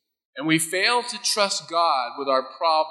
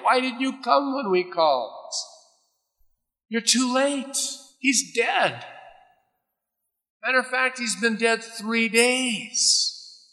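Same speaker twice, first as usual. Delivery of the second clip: higher, rising bitter complaint, then slow; bitter accusation; 5 semitones higher than usual. Retold or imitated. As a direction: accuse him of coming too late. Martha accusing Jesus, retold.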